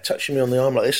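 ordinary delivery

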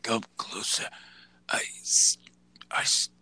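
halting whisper with long pauses; deathbed Vince. Retold or imitated. Dying boss confessing confusion in his last moments, mock deathbed whisper. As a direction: strain out hammed-up dying last words. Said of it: "And then he's done".

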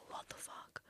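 hushed, breathless whisper; stunned whispered what the fuck; whispered swear. Retold or imitated. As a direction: mouth it under your breath in shock. Panicked aside to herself.

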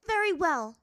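high, loud, strained acceptance; tense. A narrator's reading. Azula giving in to the master, tense. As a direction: accept through angry tension. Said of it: "she said tensely".

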